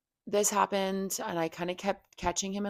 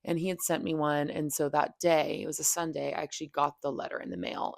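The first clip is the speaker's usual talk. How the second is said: ordinary talk, nothing marked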